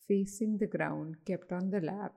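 unhurried, even-voiced relaxation coaching; guided meditation. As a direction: lead listeners gently through breathing, with pauses.

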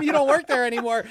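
exasperated retort. Retold or imitated. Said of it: "I told them".

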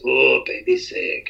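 lightly voiced Austin Powers drawl; slow, hint of Austin Powers; Mike Myers impression. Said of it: "a thing of Mike Myers from Austin Powers".

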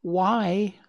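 high, hesitant little "why"; small questioning voice of a shy fawn; shy child. A narrator's reading. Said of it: "Bambi felt shy".

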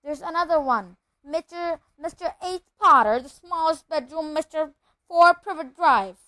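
high, excited shout. A narrator's reading. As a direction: call it out loudly down the hall. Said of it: "Then he shouted".

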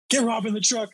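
high-pitched mock panic; panicked yell, imitated. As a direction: act out urgently ordering friends to escape. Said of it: "panicking and".

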